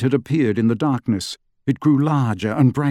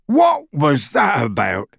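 roar; 3 semitones higher, slower than usual. A narrator's reading. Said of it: "roared Hagrid".

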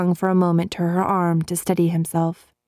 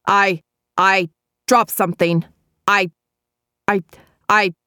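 stammering; 2 semitones higher, 4 dB louder than usual. A narrator's reading. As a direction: caught out, stumbling over the words. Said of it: "he stammered".

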